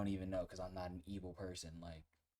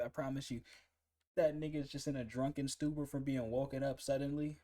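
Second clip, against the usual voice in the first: ordinary talk, nothing marked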